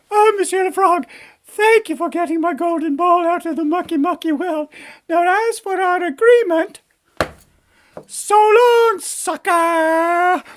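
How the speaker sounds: high-pitched, gushing princess turning gleefully dismissive; bratty princess. Acted. Princess ditches frog after getting ball, bratty princess voice. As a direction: play a spoiled princess in falsetto.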